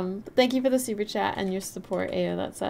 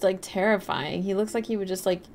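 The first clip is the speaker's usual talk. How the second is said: ordinary talk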